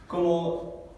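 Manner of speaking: usual speaking voice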